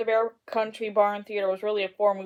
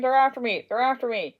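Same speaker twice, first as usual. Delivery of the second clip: higher, louder panicked repeat; frightened cry; 5 semitones higher, 6 dB louder than usual. Acted. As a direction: cry out as if being chased. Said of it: "he screamed".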